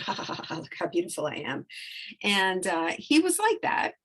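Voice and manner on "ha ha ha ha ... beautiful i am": airy fake laugh, then a fast, low vain boast; mock a showoff admiring himself; preening peacock. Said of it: "they're like peacocks".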